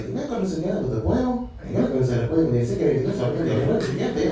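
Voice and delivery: rattled-off imitation Spanish chatter; hurried mock-Spanish mumbo jumbo. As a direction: imitate a street guy talking rapid Spanish. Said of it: "talking to each other in Spanish".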